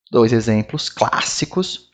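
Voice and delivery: ordinary talk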